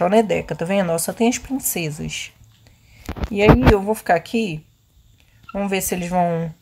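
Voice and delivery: usual speaking voice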